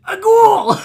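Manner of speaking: loud, high terrified scream. Acted. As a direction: shriek in terror. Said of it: "he just screams".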